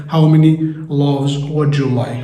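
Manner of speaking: very slow, clear repeat of the question. Shown as a demonstration. Teacher repeating the baker's question for learners, slowly. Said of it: "كم عدد الأرغفة اللي أنت محتاجها".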